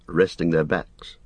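ordinary delivery, nothing marked

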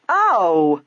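loud, startled exclamation; dismayed cry. A narrator's reading. Isabel sees the chiffon skirt torn, dismayed cry. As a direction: cry out at a sudden mishap. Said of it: "Isabel cried out".

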